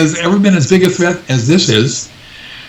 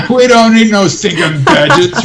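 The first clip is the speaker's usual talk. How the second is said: higher-pitched, laughing mock bandit line; laughing movie quote; 5 semitones higher than usual. Laughs while quoting movie bandits.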